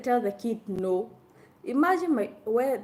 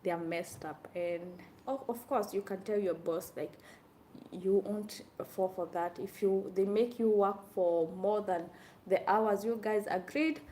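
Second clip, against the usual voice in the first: normal talking voice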